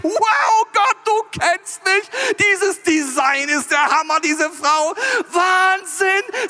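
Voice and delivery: high, loud, drawn-out raving; be blown away, voice shooting up; hammed-up awestruck shouting; ecstatic amazement. Adam thrilled on first seeing Eve.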